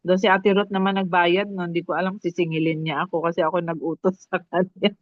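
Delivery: ordinary talk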